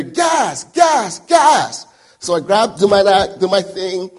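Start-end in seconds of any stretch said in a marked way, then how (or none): 0.0-1.9 loud, high imitated warning shout; shouted alarm. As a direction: shout each word out like a gas alarm. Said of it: "And you hear".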